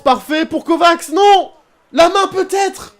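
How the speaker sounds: high, loud cry of dismay; real shout of frustration, drawn out on the last words; excited shout. Real, not acted. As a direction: yell at the screen as the chance goes. Chance missed in live match.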